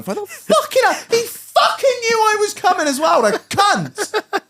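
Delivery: high-pitched exasperated swearing; retold cussing. Cursing the friend who screwed his gates, high ranting. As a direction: rant to yourself in comic outrage. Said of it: "I'm just there cussing".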